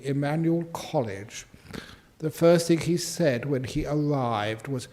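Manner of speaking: usual speaking voice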